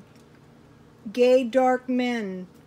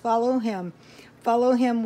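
usual speaking voice

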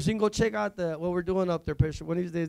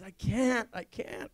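retold crying; 8 dB quieter, slower than usual. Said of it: "She's crying".

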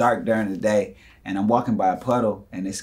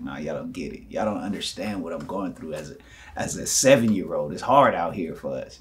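low, soft wounded complaint; mock tearful upset; 5 semitones lower, 4 dB quieter than usual. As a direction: play an overwhelmed child, quietly hard done by. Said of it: "I was so overwhelmed with anger".

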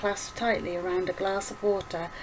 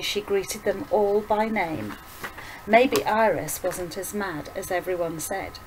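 ordinary delivery

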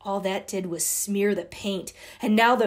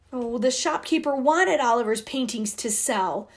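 usual speaking voice

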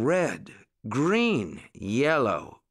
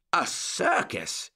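higher, slow and drawn out; surprised exclamation; 9 semitones higher, slower than usual. A narrator's reading. Uncle Wiggily hears of a real circus, surprised slow exclamation. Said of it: "exclaimed Uncle Wiggily".

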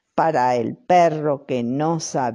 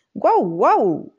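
imitated bark; 6 semitones higher than usual. A narrator's reading. The dog repeats the bark it heard.

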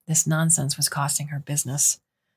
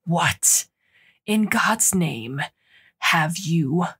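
growled; much slower than usual. Acted. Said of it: "pulled her close and growled".

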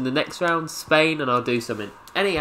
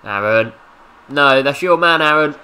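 urging shout; 2 semitones higher, 7 dB louder than usual. Real, not acted. Urging his teammate on.

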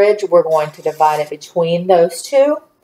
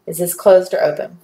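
ordinary delivery

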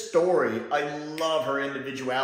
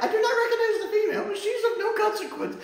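much higher and fast, prim alien voice; Alpha Centauri; faster than usual. Retold or imitated. Fan voices the alien dismissing Sarah Jane, high and fast. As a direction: rattle it off primly and much higher. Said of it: "Alpha Centauri is like".

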